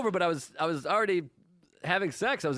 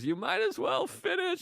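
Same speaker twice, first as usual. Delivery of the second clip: high, slow tearful whine; imitating a woman in tears; mock crying voice. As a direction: whimper it like a fake sob. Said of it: "in a crying voice".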